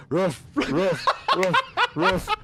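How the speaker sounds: gruff barking voice, laughing through it; dog barking. Retold or imitated. Recalling the dog ad to his co-host, laughing gruff barks. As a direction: bark it out like the ad dog, cracking up. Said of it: "the dog goes".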